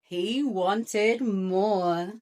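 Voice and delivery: ordinary delivery, nothing marked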